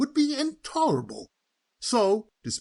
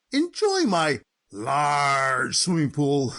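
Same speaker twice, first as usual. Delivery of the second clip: drawn-out mock politeness; 5 semitones lower, much slower than usual. Lawyer jokes about the large size.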